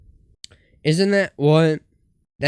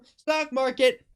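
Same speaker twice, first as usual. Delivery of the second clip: mock panicked cry; 11 semitones higher, slower than usual. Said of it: "when they were like".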